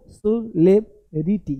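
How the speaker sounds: "सेलिब्रिटी" broken slowly into suh-LEB-ri-tee; slow, spaced "सेलिब्रिटी" with a short LEB; syllable-by-syllable demo. Shown as a demonstration. Teacher shows students what to say, slow syllable-by-syllable demo. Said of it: "क्या बोलना भाई".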